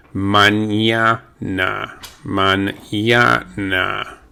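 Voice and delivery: slow mañana, ñ as NY; mañana with the NY sound of ñ; Spanish ñ. Shown as a demonstration. Teacher modelling mañana slowly for students. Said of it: "the N is pronounced as if it were an N Y".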